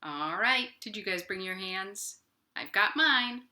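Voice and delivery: ordinary delivery, nothing marked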